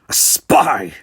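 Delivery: loud rasping accusation; harsh rasping Cardassian. Acted. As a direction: bark it out as a harsh alien soldier. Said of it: "rasped a harsh Cardassian voice".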